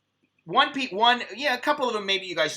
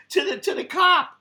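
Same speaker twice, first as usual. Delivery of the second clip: high-pitched, louder, through laughter; laughing; 11 semitones higher, 4 dB louder than usual. Real, not acted. Storyteller amazed he challenged a cop, laughing. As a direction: laugh through the words in disbelief.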